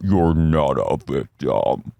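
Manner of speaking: low, slow, warped version of the line; distorted slow mishearing. Retold or imitated. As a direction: drag it out low like it's heard through a fog. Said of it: "the way Shannon hears it".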